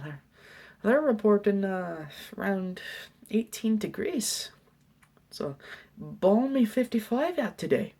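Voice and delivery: high, loud, hammed-up Irish accent. Janitor jokes about morning frost with student, Irish accent. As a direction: chat about the weather in a put-on Irish voice. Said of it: "I do that accent".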